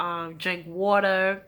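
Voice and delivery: usual speaking voice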